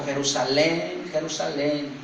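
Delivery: slow, subdued grieving call; tearful lament. Acted. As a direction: mourn over a beloved city, drawn out. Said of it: "comenzó a llorar y dijo".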